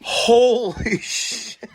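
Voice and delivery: drawn out with a laugh, high; laughing disbelief. Real, not acted. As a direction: laugh through shocked swearing. Disgust at dirty blower.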